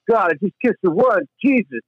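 high whiny mocking boyfriend; mock Barry. Mocking Barry yelling at Heather in the car, high and whiny. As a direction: sneer it like a possessive jerk.

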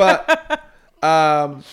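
laughing while trying to talk. Real, not acted. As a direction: speak through a laugh. Laughing after joke.

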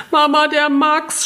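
put-on high, slow, tearful child's scream; crying child's cry. A narrator's reading. Little girl crying to her mother about her brother, tearful scream. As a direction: wail it like a hurt little girl. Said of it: "schrie sie".

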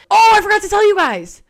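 high, loud burst of remembering; sudden recall. Real, not acted. Vlogger suddenly remembering news for her viewers, excited. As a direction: blurt it out as it hits you.

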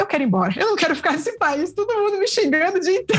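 rising plaintive protest, lightly acted; mock dismay. Retells her dismay on arriving.

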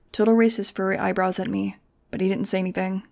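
ordinary delivery

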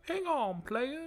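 amused imitation of the old GM's catchphrase; Teddy Long impression. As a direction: squeak it like a sixty-something showman. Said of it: "is like".

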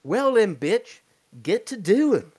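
loud, high hillbilly yell; hillbilly ordering the girl, loud and high; hillbilly shout. Retold or imitated. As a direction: bark it out like the hillbilly. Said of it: "he's like".

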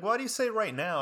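high, puzzled imitation of the counselor. Counselor puzzled by the student's wording, high voice. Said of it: "She's like".

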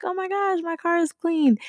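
high-pitched retold "oh my gosh" excitement; delighted surprise. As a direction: squeal it lightly, acting out happy amazement. Retold delighted reaction to gift.

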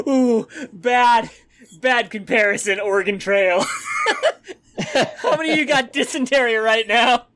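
high laughing teasing. Real, not acted. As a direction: laugh through the words. GM laughing at comparison.

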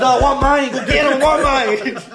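high, repetitive mock demand, laughing; insistent drunk friend. Friend mimicking him demanding payback from cops, mock insistence. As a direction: imitate a friend who will not let it go. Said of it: "He's like".

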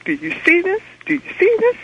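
high, urgent retelling of a frantic question; retold frantic excitement. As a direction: relive the panic of begging a stranger to look. Frantic caller retells seeing the sight.

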